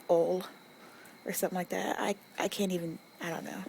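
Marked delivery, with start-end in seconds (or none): 0.1-0.4 "ole" said as one syllable, rhyming with hole; imitating others' oil. Mimicking neighbours, she says oil as ole. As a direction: clip "ole" like a single vowel. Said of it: "some people as well who say".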